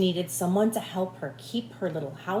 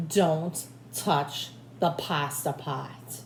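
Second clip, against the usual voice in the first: slow warning; 2 semitones lower, much slower than usual. Acted.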